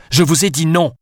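loud, high-pitched refusal; shout. A narrator's reading. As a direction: shout her down with a flat no. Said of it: "cria-t-elle". (Alice crie son refus à Gabriel)

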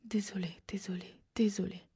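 répété en chuchotant, de plus en plus bas; murmuré. A narrator's reading. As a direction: des excuses qui s'éteignent dans un souffle. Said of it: "murmura Sal". (Sal répète ses excuses au frère, chuchoté)

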